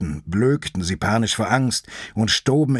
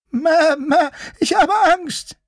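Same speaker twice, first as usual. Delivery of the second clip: very high, bleating little sheep voice; high-pitched timid lamb, begging; frightened sheep-lion; 5 dB louder than usual. Acted. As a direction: plead in a tiny high voice, bleating loudly.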